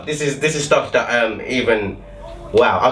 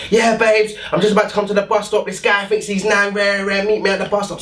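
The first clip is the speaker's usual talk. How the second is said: put-on higher, quick girl on the phone to her man; 7 semitones higher than usual. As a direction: imitate the girl calling backup. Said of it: "she was like".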